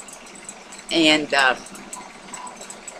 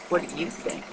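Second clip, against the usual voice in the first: much softer, gently put question; soft probing question; 11 dB quieter than usual. Retold or imitated. Casually asking women friends, soft probing question. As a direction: drop the volume and ask it gently. Repeats the question more softly.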